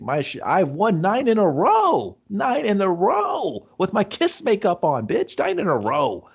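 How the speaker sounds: high, slow, gloating brag; crowing high over his winning streak; mock gloating boast. Villain brags to Quan Chi, high slow gloat.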